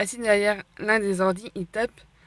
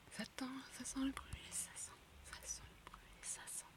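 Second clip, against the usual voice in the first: unvoiced sneaky whisper; 5 semitones higher, 26 dB quieter than usual. Real, not acted. Sneaking through the school, whispering.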